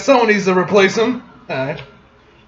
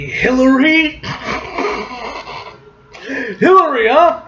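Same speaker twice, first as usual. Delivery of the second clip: mocking taunt; 6 semitones higher than usual. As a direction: tease her with the name.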